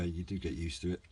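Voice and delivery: usual speaking voice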